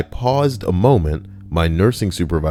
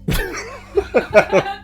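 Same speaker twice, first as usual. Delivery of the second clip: high breathy laugh. Real, not acted. Laughs at own impression.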